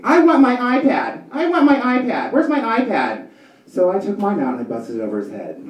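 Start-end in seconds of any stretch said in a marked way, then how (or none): 0.0-3.2 mock kid tantrum. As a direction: throw a hammed-up tantrum. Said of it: "he starts screaming".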